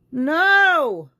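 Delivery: high, loud shocked no. Real, not acted. Hearing the smear on [name], a shocked no. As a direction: cry out in disbelief.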